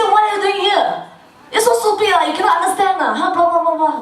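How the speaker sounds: high-pitched scolding parent; acted exasperated telling-off; mock angry yelling. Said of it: "you yell at them".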